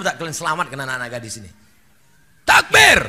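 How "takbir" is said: loud, high call of "Takbir"; shouted takbir. Real, not acted. Preacher shouting takbir to rouse the crowd.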